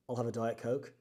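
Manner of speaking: a hint of a real Australian boss; Australian accent. Boss ordering in his real Australian accent. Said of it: "flawless accent".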